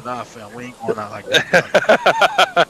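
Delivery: slow, loud mock tough-guy delivery; mock tough crew line. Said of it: "They go".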